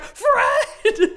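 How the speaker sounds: very high, drawn-out spooky call; spooky Fred. Retold or imitated. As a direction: call his name like a ghost outside the window. Alice's spooky call at window.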